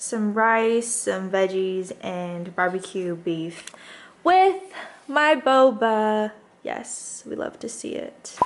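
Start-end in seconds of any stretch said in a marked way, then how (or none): 4.2-6.3 excited. Real, not acted. Shows off her boba drink. 6.6-8.4 breathy excited whisper. Real, not acted. Whispered delight at food.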